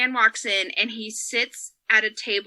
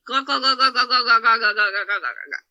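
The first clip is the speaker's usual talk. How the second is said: rhythmic glug glug, fading down; pouring sound. Retold or imitated. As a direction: mimic liquor pouring. Imitating pouring vodka.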